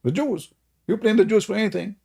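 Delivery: ordinary talk, nothing marked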